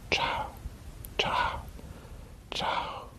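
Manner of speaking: fading whispered goodbyes. Real, not acted.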